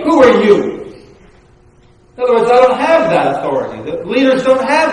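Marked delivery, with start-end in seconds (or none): 0.0-0.8 raised, indignant "Who are you?"; mock indignant church member; indignant retort. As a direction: snap back, offended. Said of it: "Bob could say".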